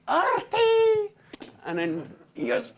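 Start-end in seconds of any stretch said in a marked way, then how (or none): 0.1-1.1 very high, loud scream of the name; a hammed-up frantic yell; desperate scream. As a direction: cry out in terror. Desperate cry as dragon strikes.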